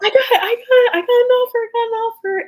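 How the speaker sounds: high-pitched, squealing mock scream; excited scream. Athlete retelling her scream at getting an offer, excited squeal. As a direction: squeal it out in giddy disbelief, voice way up. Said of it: "like, screaming".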